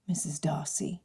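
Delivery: Missus Darcy breathed in a soft whisper; whispered name. A narrator's reading. Groom whispering his bride's new name to himself, hushed. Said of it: "with emphasis on the different syllables".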